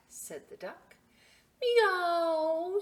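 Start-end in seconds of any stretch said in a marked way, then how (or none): 1.6-2.8 cat meow. Acted. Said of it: "said Cat".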